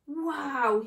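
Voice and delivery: awestruck, bowled over; gasped wow. Acted. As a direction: let out an amazed gasp. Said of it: "he gasped".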